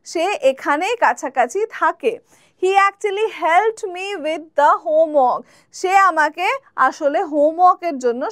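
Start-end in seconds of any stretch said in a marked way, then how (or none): 2.6-5.4 very slow, clear classroom read; slow, careful teacher example; slow example sentence. Shown as a demonstration. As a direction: spell it out slowly for learners. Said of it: "example sentence".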